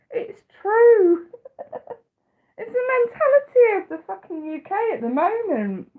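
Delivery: words squeezed out through real high laughter; can't stop laughing while ranting. Real, not acted. Ranting to the stream about the UK, laughing helplessly. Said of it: "I shouldn't laugh".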